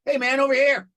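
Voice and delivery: calling out. Retold or imitated. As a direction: wave him over with your voice. Calling Iggy over across restaurant.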